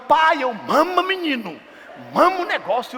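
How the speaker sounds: high, loud, exasperated pleading; frantic father. Retold or imitated. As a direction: act out a dad at his wits' end with a baby. Frazzled father urging crying baby.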